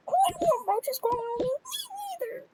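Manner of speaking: high, wailing dread; squeamish panic. Pretend. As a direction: whine in high-pitched mock horror. Acted panic about roaches.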